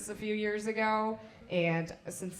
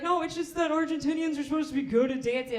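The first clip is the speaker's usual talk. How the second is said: higher, louder insistent guy; pushy guy insisting; 7 semitones higher, 5 dB louder, faster than usual. Retold or imitated. Guy pressing her about Argentinians and dancing, insistent. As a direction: nag her about dancing, slightly whiny. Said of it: "They'll be like".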